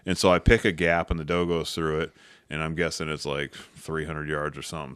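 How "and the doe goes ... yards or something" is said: ordinary delivery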